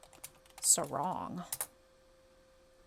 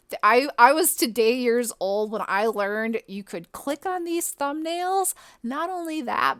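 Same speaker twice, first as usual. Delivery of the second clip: higher, louder rush of delight; giddy excitement; 3 semitones higher, 8 dB louder than usual. Real, not acted. Gamer tells viewers she just learned a wiki trick, giddy and loud. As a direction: gush like it's the best news ever. Gushing over wiki discovery.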